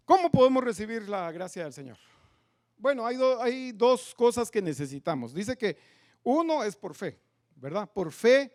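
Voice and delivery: loud, emphatic sermon delivery; full-voiced preaching; preaching at full force. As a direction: preach it out to the pews.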